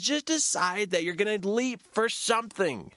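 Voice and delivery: high encouraging urge; encouraging facilitator. Retold or imitated. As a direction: push him to just leap. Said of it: "She goes".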